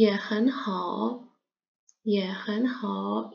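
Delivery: drawn-out syllables; slow model. Shown as a demonstration. Teacher models the reply very slowly. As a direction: stretch each syllable slowly. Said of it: "teacher models reply".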